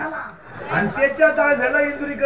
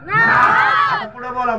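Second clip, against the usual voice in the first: slow, high, louder urging; urging call; 10 semitones higher, 4 dB louder, much slower than usual. Real, not acted. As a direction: call the crowd on, drawn out. Preacher urging crowd to answer. (खेचून मोठ्याने हाक)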